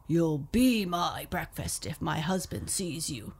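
loud, heavy giantess warning; booming giant's wife; giant woman voice. Acted. Giant woman warns Jack.

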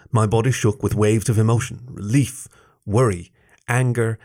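ordinary delivery

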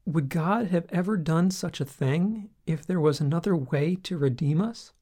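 usual speaking voice

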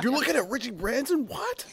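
high, squeaky voice, baffled; mock disbelief. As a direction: imitate a stunned fan, pitch way up. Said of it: "They're just like".

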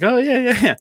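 hammed-up enthusiastic agreement; mock agreement. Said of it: "everybody's like".

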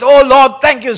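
loud, high, excited prayer. Retold or imitated. The one praying gets excited and cries out. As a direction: pray as if carried away. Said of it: "he starts with".

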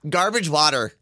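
"Garbage water" said with the Jersey "water"; New Jersey accent. Shown as a demonstration. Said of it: "No, I don't say that".

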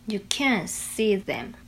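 slow careful can't with stress; slow practice repeat. Shown as a demonstration. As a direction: repeat slowly, leaning on can't. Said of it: "那你再教我们讲一次".

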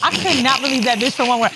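ordinary talk

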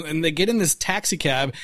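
ordinary delivery

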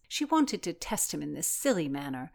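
ordinary delivery

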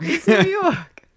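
high, slow hammed-up New York drawl; New York accent. Admitting she can't stop talking New York, slow high New York accent. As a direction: stretch it out like a brash New Yorker. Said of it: "cannot stop talking with a New York accent".

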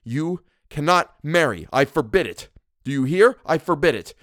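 high, forceful command; shouted. A narrator's reading. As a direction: forbid it at a shout. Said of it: "I shouted".

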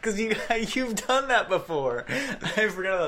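high, laughing through the words; real amused laughter while recalling. Real, not acted. Game master laughing as he remembers Kosef's drinking win.

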